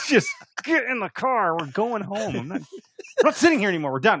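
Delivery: acted-out exasperated demands; losing it. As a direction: re-enact picking a fight. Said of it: "Just started losing my shit".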